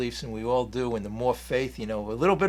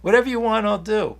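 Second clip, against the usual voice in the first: smiling placation; 6 semitones higher, 3 dB louder than usual. Pretend. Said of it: "I could say to them and smile and say".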